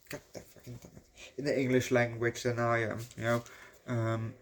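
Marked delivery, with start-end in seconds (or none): 0.1-1.0 muttered frustrated curse under the breath. Real, not acted. Grumbling at his own forgetfulness, cursing under his breath. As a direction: swear quietly at yourself for losing the word. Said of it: "frustrated at losing word".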